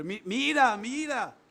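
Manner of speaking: high, loud mocking call; mocking yell at Abner; shouting. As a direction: taunt him with a yell, then fade to a sneer. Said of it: "y gritándole".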